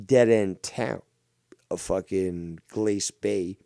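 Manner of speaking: usual speaking voice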